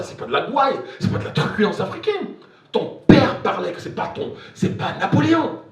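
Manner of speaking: loud, high protest; retold retort. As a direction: cut them off and set them straight. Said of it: "Je dis".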